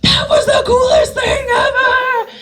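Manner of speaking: high, drawn-out gushing cry; thrilled. Retold or imitated. Director reacting to the actor's successful take, thrilled. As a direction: squeal it slowly, overcome with delight.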